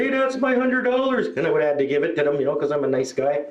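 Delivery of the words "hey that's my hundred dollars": higher mock cry of ownership; calling out a claim. Imagined stranger claims the found money, calling out high. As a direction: call out to claim it.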